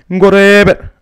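loud, high, slow and flat. Retold or imitated. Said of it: "como más directo, como más plano el sonido".

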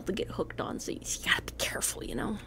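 hushed warning whisper. Acted. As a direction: whisper it like a secret.

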